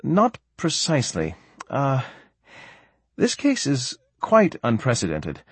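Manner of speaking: measured doctor feeling for an answer; hesitant. A narrator's reading. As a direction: hedge it, pausing to pick the words. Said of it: "Doctor Singh hesitated".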